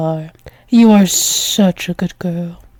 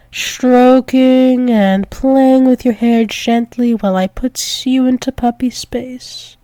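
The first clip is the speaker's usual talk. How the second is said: usual speaking voice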